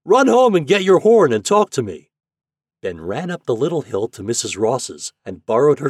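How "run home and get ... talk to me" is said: high, very loud captain's shout; hollering to someone far away; roared order. A narrator's reading. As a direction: bellow instructions across the water. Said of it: "roared the captain".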